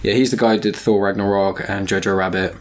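ordinary delivery

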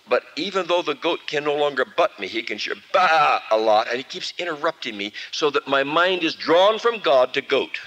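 ordinary talk

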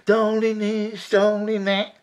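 lightly hammed-up luvvie darling; mocking actors. Inspector mocking actors' luvvie talk, lightly hammed. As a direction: send up theatrical actors, mildly. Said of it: "They're actors".

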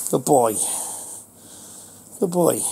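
ordinary delivery

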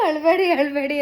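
high, loud imitation of her daughter pleading through laughter; daughter comforting. Retelling daughter begging her crying mother, high and loud. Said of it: "ಅಂತ ಹೇಳ್ತಾ ಇದ್ಲು".

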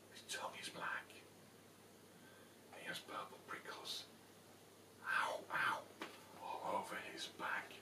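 hushed, breathless whisper. A narrator's reading. Storyteller whispering the scary description to children. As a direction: whisper it so the Gruffalo won't hear.

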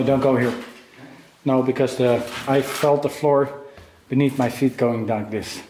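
normal talking voice

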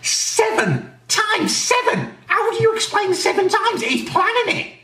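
high-pitched comic shock; shocked disbelief. Acted. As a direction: stretch it out in outraged disbelief. Outraged reaction to seven times.

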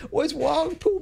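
put-on high cooing baby talk; mock baby talk. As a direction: coo it at a grown man. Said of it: "they talk like babies".

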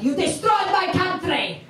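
loud, high mock outburst; angry accusation. Cab driver blames British passenger, angry shout. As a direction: yell it as an indignant accusation.

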